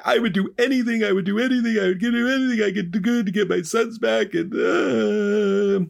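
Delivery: very high, hammed-up tearful begging; mock sobbing plea, tailing into a moan; desperate pleading. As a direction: wail it like a comically distraught father. Grieving father pleads for his sons.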